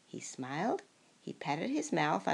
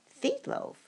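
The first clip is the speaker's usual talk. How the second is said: surprised cry; 10 semitones higher than usual. Acted. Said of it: "cried the townspeople".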